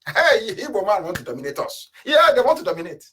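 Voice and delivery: high-pitched mocking imitation of accusers; mock jeering. Imitating Middle Belt people laughing at Igbos, mock jeering. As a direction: mimic them jeering, voice jumping up. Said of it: "those of them in the Middle Belt were laughing".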